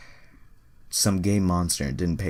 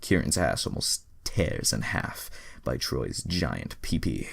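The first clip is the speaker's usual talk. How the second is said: ordinary delivery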